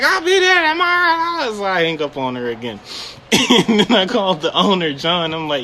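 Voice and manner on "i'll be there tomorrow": high, stretched-out ranting caller; mock fussing. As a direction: mimic her fussing on as you hang up. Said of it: "still fussing".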